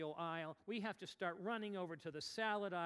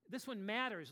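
normal talking voice

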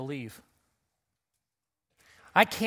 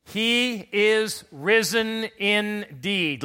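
slow, drawn-out and higher; slow emphatic response; 5 semitones higher, 5 dB louder, much slower than usual. Shown as a demonstration. Pastor modeling the response, slow and emphatic. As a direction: spell out each word for the church. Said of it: "Let's give it some emphasis".

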